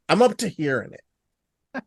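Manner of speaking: spelled out slowly; slow it right down, word by word; slow and deliberate. Real, not acted. Repeats the line more deliberately.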